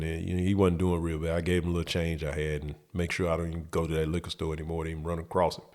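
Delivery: usual speaking voice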